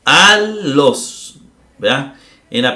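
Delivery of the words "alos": Alos said with the first syllable punched high and loud; stress on first syllable. Shown as a demonstration. Teacher to students, showing the stress on Alos. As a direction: hit the A of Alos hard and long. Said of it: "Vea que aquí alos es en la penúltima".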